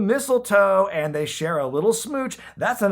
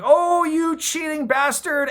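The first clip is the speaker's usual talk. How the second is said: much higher, louder, slow shrill outrage; mock angry wife; 12 semitones higher, 4 dB louder, slower than usual. Fake Tiger Woods' wife chasing the cheater, mock outrage. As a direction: play the scorned wife hamming it up.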